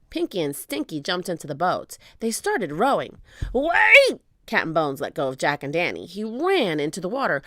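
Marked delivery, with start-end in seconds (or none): 3.5-4.2 high, loud holler; desperate cry. A narrator's reading. Captain crying out as his men board the boat. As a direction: call out after a departing boat. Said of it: "cried Cap'n Bones".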